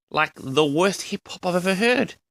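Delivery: usual speaking voice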